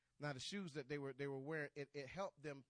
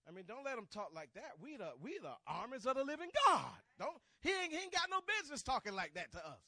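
high, loud preacher voicing David's outrage; indignant outcry; 8 semitones higher, 11 dB louder than usual. David to his brothers about Goliath's insults, indignant shout.

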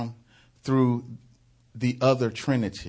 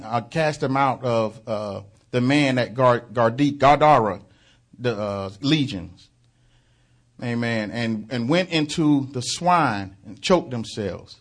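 ordinary talk

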